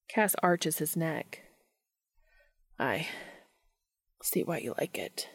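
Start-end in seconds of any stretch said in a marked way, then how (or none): 2.8-5.3 low, soft, whispery and breathy; shallow huffed breath. A narrator's reading. Cass replying to Dean, in shallow breathy huffs. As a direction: let it out haltingly on little breath. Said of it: "his breath coming as shallow huffs".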